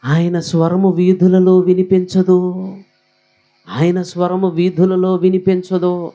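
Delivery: lightly voiced divine rebuke; voice of God. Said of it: "ఆయన అంటాడు". (దేవుని స్వరంలా నెమ్మదిగా)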